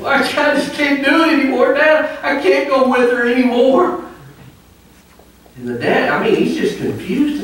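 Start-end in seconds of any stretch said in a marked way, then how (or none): 0.0-4.0 high, whining son near tears; whiny despair. Retold or imitated. Son complaining to his dad, high and whining. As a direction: wail it like a worn-out son, hammed up. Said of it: "He said".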